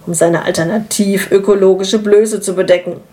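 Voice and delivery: ordinary delivery, nothing marked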